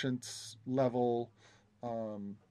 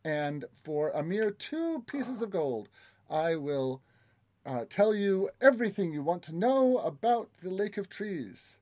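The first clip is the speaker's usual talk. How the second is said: oily flattering courtier; 5 semitones higher, 4 dB louder than usual. Acted. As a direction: sell the tour with oily charm. GM continues guide NPC.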